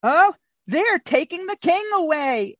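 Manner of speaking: normal talking voice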